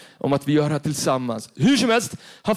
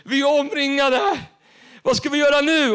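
high, panicked plea, voice pitched up; frightened cry; 8 semitones higher than usual. Acted. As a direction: cry out to the Lord, terrified and surrounded. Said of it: "blev han orolig och ropade".

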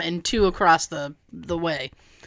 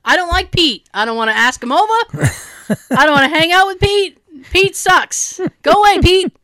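high-pitched comic tantrum; acted-out petulant outburst; exasperated rant; 5 semitones higher, 3 dB louder than usual. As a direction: rant with mounting mock indignation. Acted rant against snake Pete.

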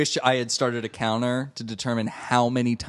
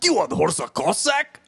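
usual speaking voice

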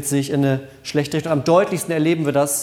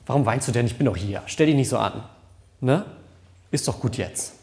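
lower, brushing off her tears; dismissive; 4 semitones lower than usual. Shown as a demonstration. Pastor demonstrates a wrong, dismissive Jesus, brushing her off. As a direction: shrug off the crying, mildly impatient. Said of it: "dass Jesus sagt".

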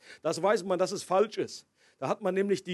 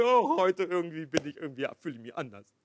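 mock moodiness; 3 semitones lower than usual. Preacher mimics someone waking up in a mood, mock moody. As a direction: play someone waking up out of sorts. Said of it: "Gott wird nicht launisch".

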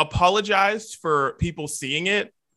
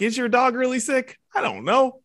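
mock doubtful; 9 semitones higher than usual. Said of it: "I'm like".

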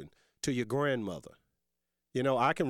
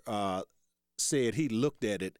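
ordinary talk

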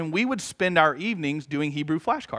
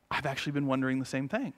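soft confession; 5 semitones lower, 11 dB quieter, faster than usual. Retold or imitated. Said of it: "she looked at me and said".

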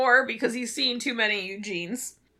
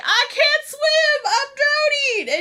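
high, slow, loud mock panic cry; 5 dB louder, slower than usual. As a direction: wail for help like a drowning man, hammed up. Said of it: "And Weatherby's like".

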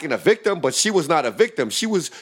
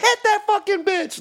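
much higher cheering shout; mock crowd yell. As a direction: yell like a fired-up bystander. Feminists cheering the fight.

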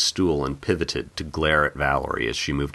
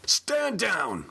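high, sharp cop command; barked command. Acted. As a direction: bark the order at him. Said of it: "Brett barked".

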